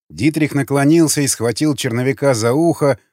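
plain reading voice

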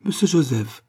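high surprised cry on "Monsieur"; cry of surprise. A narrator's reading. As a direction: call out the name, caught off guard. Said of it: "poussa un cri".

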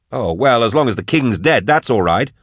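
high-pitched mock acceptance; scathing sarcasm. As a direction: pretend to agree, heated and sarcastic. Drusha sarcastically echoes Eisenhorn.

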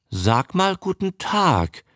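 mildly astonished request; amazed. A narrator's reading. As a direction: coax it slowly in wonder. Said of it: "sagte der verwunderte Herr Greggs".

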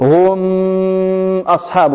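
exaggerated drawn-out nasal "mmm" in هم; غنة زائدة. Shown as a demonstration. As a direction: hum the ميم of هم very long before أصحاب. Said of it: "هذا يعتبر غنة". (إطالة ميم هم بغنة خاطئة)

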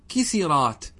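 كسرات said "kisirāt", i after the s; kasra on the sin. Shown as a demonstration. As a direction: say كسرات with the first i echoed, ki-si-rāt. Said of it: "فتكسر السين اتباعا لكسرة الكاف". (كسرات بكسر السين)

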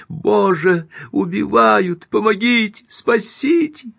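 high-pitched desperate cries for help; frantic screams. A narrator's reading. Victim behind a locked door calls for rescue, frantic screaming. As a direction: scream for your life, pitch climbing. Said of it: "потом истошные крики".

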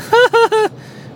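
short high-pitched laugh; high giggle. Real, not acted. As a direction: giggle at your own pun. Laughing at own joke.

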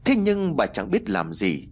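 plain reading voice

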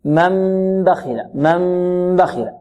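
من بخل with the n as a long, nasal hummed m; iqlab with ghunna. Shown as a demonstration. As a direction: hum a held meem into the baa of من بخل. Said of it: "ويكون معه إخفاء". (من بخل بإقلاب وغنة مع الإخفاء)

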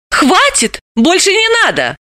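high, alarmed plea to stop; frightened. A narrator's reading. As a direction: cut him off in fright. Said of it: "испуганно известив".

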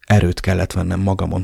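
ordinary delivery, nothing marked

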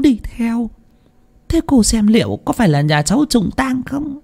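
high, hushed, hesitant pleading; a timid villager stammering out her fear; stammering fearfully. A narrator's reading. Thắm stammers her fears to the seer, high and hushed. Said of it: "ấp úng".

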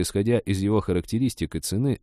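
ordinary delivery

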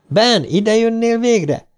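a sharp voice cutting through library silence; high, slow, piercing call across the room. A narrator's reading. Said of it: "hasított bele ... egy éles hang".

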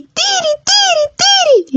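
very high piercing bird call; mother bird's warning. Acted. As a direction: cry out like a mother bird guarding her nest. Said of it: "le avisó la mamá Tero".

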